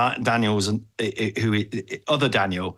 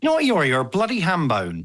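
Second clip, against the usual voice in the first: high-pitched mock scolding; higher, hammed-up reprimand; mock telling-off; 6 semitones higher than usual. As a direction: play an exasperated boss telling someone off. Joining in, acting out the telling-off.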